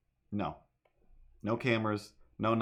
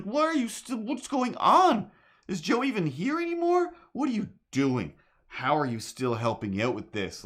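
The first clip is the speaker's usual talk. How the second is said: incredulous questioning; 10 semitones higher, 4 dB louder than usual. Retold or imitated. Retold character's incredulous outburst.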